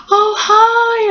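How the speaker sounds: very high, bright hello; elated greeting. Retold or imitated. Patient greeting mum after surgery, very high and elated. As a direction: greet her as if overjoyed to see her. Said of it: "I was like".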